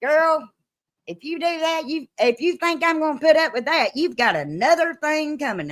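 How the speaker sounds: high-pitched scolding mother, lightly voiced; a hint of a mother scolding her daughter; scolding parent. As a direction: warn the girl like a stern mama. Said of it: "I heard it a hundred times".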